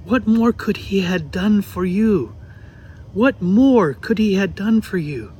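put-on a grieving mother in a light New York accent. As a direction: speak it slowly in a thick New York accent. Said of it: "she had this thick New York accent".

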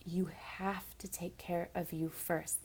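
soft unvoiced whisper. Real, not acted. Speaking to struggling viewers, a soft whisper. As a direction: breathe it gently to them. Urgent personal plea.

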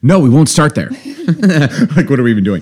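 surprised outburst. Real, not acted. Reacting to cohost saying no.

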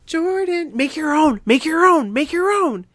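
loud, high chant of make your own; playful urging. Pretend. Urging Jordan to make his own card, loud playful chant.

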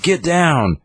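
high-pitched, drawn-out imitated warning; mock warning cry. Acting out an urgent warning.

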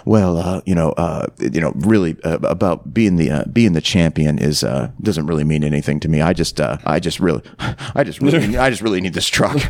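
low, hesitant mumble full of uh; stuttering shy trucker. Retold or imitated. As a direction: play a bashful man stumbling over words on camera. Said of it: "He's, like, stuttering".

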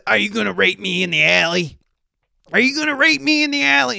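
high-pitched impression of a yelling old street woman; imitating a street lady. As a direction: squeal the accusation down the block. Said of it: "she's basically".

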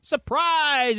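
high, drawn-out "surprise" call; party surprise. Acted. Podcaster retelling the guests' arrival at the party, mock surprise.

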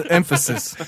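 "Emphasis." said through a laugh. Shown as a demonstration. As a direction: laugh while repeating "Emphasis.". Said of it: "Didn't he say it was special education?".

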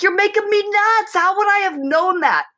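high, mock-frustrated complaint; man baffled by a woman's logic, hammed-up frustration; exasperated husband. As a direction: throw up your hands in pretend exasperation. Exasperated man in gender bit.

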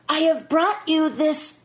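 lightly voiced high, slow line reading; a hint of a halting, high script read. Actress mimics co-star reading his script, lightly imitated. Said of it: "he says".

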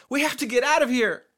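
high, loud, tearful-scene scream; desperate scream. A narrator's reading. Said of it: "he screamed".

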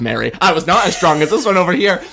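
ordinary talk

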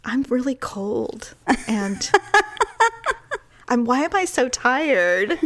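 mock self-pitying husband, half-hearted; mock sick husband. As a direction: play up being ill for sympathy. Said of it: "he's like".

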